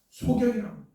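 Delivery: ordinary delivery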